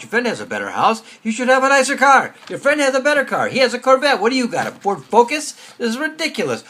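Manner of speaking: loud, high hectoring inner voice; nagging yell. Retold or imitated. Yetzer Hara scolding you about what you lack, nagging yell. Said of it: "this guy's over here yelling at you".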